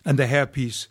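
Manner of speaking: usual speaking voice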